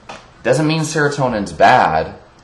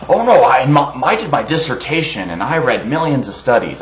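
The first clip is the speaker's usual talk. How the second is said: mocking imitation; 5 semitones higher than usual. Ranter mimicking vegan who claims to know science, mocking. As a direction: parody an indignant know-it-all.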